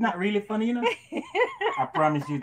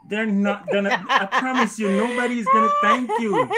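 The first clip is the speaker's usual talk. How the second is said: laughing while teasing; 6 semitones higher, 8 dB louder than usual. Real, not acted. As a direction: tease through laughter.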